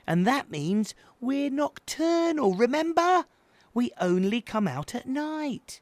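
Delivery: exasperated big brother, pitched high; tutting. A narrator's reading. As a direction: lecture the little one with weary patience. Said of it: "shook his head slowly and tutted".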